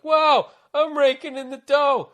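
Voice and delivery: high, slow mock delight; hammed-up wonder at the money; mock amazed. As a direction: squeal it like a thrilled kid. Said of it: "And I was like".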